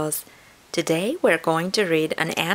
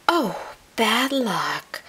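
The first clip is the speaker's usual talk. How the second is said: high, drawn-out sympathy; sympathizing; 7 semitones higher, slower than usual. A narrator's reading. Chipmunk to possum who lost his home, sympathizing. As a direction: commiserate slowly in a little chipmunk voice. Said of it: "the chipmunk sympathized".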